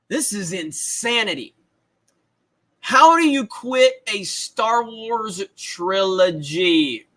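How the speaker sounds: normal talking voice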